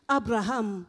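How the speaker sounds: ordinary delivery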